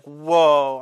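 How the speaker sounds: loud startled "Whoa"; sudden loud mock surprise; retold shock. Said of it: "I'm like".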